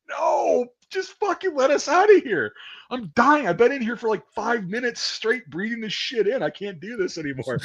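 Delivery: high, desperate whine, slowing and fading; panicked pleading. Retold or imitated. Recruit begs to leave gas chamber, high panicked whine. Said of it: "And I'm like".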